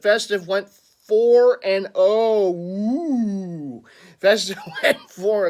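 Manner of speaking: drawn-out, loud, high mock-ghost wail; horrible ghost impersonation. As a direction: wail it like a badly faked ghost. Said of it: "that was a horrible ghost impersonation".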